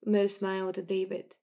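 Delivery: plain reading voice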